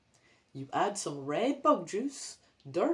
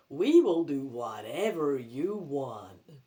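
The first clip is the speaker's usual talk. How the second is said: zombie grandpa; 5 semitones lower, slower than usual. Acted.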